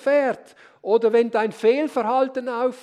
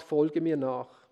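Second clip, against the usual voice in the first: gentle call; 8 semitones lower than usual. Acted. Preacher voicing Jesus calling followers, low and slow. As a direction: invite them tenderly.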